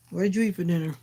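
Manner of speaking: normal talking voice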